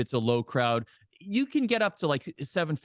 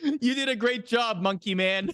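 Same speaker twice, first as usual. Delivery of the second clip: high, mock-praising voice; teasing wrestler; 9 semitones higher than usual. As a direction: pat him on the back, mocking him. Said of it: "And Tiger goes".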